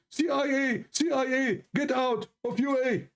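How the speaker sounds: high-pitched imitation of a chanting crowd; mock crowd shouting. Protesters at the palace against the president, mock shouting. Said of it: "they start shouting".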